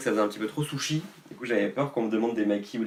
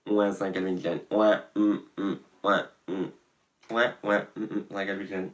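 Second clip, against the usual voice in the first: lightly voiced smug brand bragging; smug show-off. Vlogger imagining himself bragging this winter, mild smug hums. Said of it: "en disant ouais".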